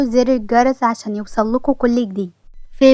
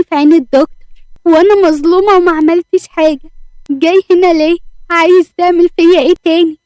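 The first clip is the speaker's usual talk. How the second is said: higher, louder furious tirade; angry outburst; 5 semitones higher, 5 dB louder than usual. A narrator's reading. Salma confronting the man who got her fired, furious shouting. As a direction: rage at him for hounding her, voice raised high. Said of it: "فتحت سلمى الباب بعصبية".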